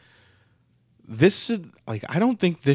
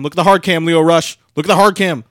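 mock coaching shout; 3 semitones higher, 6 dB louder than usual. Trainer directing a wrestler to camera, mock coaching shout. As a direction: call it out like a drilled instruction.

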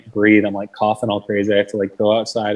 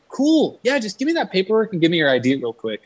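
high, easygoing helpful dude; retold chipper helpfulness; breezy clerk; 10 semitones higher than usual. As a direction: imitate an upbeat guy, pitched way up. Imitating evidence clerk.

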